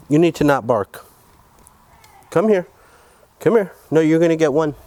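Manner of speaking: high, loud scolding of a dog. Real, not acted. Owner scolding his dog for barking, high and loud. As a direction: call the naughty dog to you, firm and loud.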